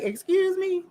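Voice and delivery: high-pitched, affronted question; retold indignant "excuse me"; mock indignant. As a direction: act out someone taken aback. Acting out his indignant interruption.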